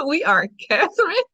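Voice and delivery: giggling through the words; laughing. Real, not acted. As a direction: laugh while saying it.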